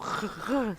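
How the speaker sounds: throaty, rasped "kh" noise; imitated guttural Dutch sound. Shown as a demonstration. Showing viewers Dutch pronunciation, rasping throat noises. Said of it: "they use a lot of".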